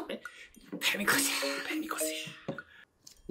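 unvoiced, slow whisper. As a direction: breathe it out as a whisper. Whispered sound word in game.

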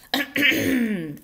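loud, pointed throat clear; reminding throat clearing. A narrator's reading. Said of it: "cleared his throat in a reminding".

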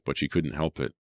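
plain reading voice

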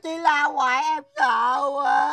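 slow, childish mock crying; pretend-scared bawling; tearful whine. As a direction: wail it, dragging the words out. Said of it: "Nín thì chị cho kẹo".

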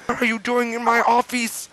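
high, hammed-up indignant old wizard; angry Dumbledore. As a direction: squeak out the outrage as a cross headmaster. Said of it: "He began to shoot angrily".

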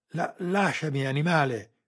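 high, loud, stammering struggle; woman pinned down, protesting loudly; desperate protest. A narrator's reading. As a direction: fight him off with your voice. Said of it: "protestò la donna".

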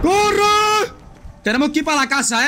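panicked yell to run; fleeing in a frantic rush; being chased. Real, not acted. Fleeing Lucifer in the game.

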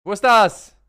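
loud, high exasperated call; frustrated shout. Real, not acted. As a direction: shout it out while searching impatiently. Searching settings, loud outburst. (genervter lauter Ausruf)

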